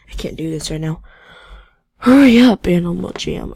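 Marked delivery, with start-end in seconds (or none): 2.0-3.5 impatient shout. Real, not acted. Player yells at a lagging game, loud and impatient. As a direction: yell it, sharp and much louder.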